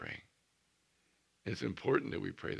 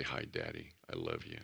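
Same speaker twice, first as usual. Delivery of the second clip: lower, soft and loving; tender hi Daddy; 14 semitones lower, 7 dB quieter than usual. Shown as a demonstration. Preacher showing how to greet God, low and soft. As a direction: say it softly like a child to his father. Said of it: "Let's make sure we say".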